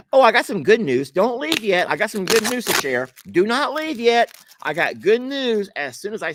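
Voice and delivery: high, loud and eager; bursting with good news; excited. Real, not acted. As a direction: grab them before they click away. Excited news announcement to viewers.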